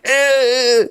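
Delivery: high pinched grunt; a tight, strangled little eh; strained neck grunt. Retold or imitated. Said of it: "It's like".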